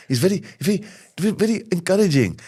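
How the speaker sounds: high, light, bass-free voice; nice Ramesh. Retold or imitated. Comic showing his shopkeeper character, high and eager. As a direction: lift it up and make him sweetly encouraging. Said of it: "take all the bass out of his voice".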